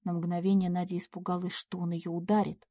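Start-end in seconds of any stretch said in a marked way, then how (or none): none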